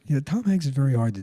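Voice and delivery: usual speaking voice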